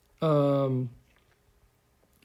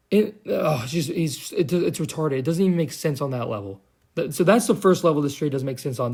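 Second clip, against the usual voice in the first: ordinary talk, nothing marked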